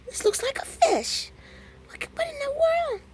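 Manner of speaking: squealed, baffled disbelief; high-pitched voice. Retold or imitated. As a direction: jump way up into a squeaky high register. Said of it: "I got my high-pitched voice going on".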